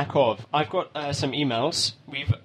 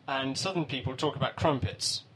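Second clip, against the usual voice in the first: ordinary talk, nothing marked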